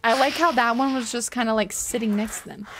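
normal talking voice

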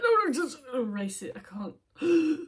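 flustered mock plea; imitated panic. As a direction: act out a flustered deleting. Acting out their hesitation.

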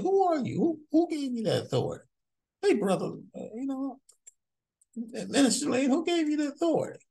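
imitating Lee. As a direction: put on a high, needling questioner's voice, pushing the question. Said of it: "that's what Lee said".

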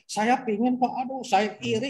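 high-pitched mock pleading; acted envious plea. Envious churchgoer begging the pastor, mock high-pitched plea. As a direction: imitate an envious complainer, pitched well up.

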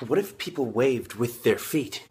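ordinary talk

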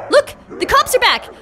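high, urgent call; alarmed. Pretend. Player spots the cops returning, alarmed.

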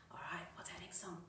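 quick whispered aside. Real, not acted. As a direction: murmur it to yourself. Aside to herself between songs.

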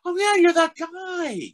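slow, very high, loud drawn-out recognition; imitated gawking passengers. Bus riders all recognize the actor, slow high-pitched gushing. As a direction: stretch it out in a squeaky onlooker voice. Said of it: "everybody on the bus is looking".

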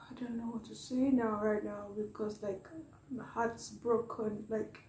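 soft, heartbroken, close to tears; real tearful state. Real, not acted. Reactor to viewers after a moving video, tearful and hushed. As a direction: fight back tears, barely voicing it. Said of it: "I could not hold back the tears".

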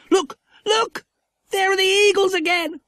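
very high, loud thrilled call; excited shout. A narrator's reading. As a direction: point and shout in delight. Spotting eagles, excited.

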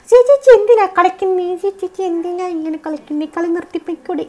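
high pestering mock-viewer voice; mock viewer whine. Streamer mimicking chat viewers pestering her, high mock whine. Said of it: "പിന്നെ നിങ്ങൾ പറയും".

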